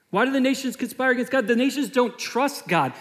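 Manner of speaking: high, driving preacher's cry; preaching at full force. Acted. Preacher to his church, rising in full force. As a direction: press the question hard on the congregation.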